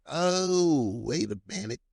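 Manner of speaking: drawn-out oh of mild alarm; retold dawning surprise. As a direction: act out a sudden, hushed realization. Retells sudden realization in car.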